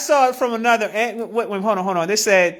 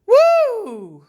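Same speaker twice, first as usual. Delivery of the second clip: whooping exhale; 10 semitones higher, 4 dB louder than usual. Real, not acted. Exclaiming after laughing fit.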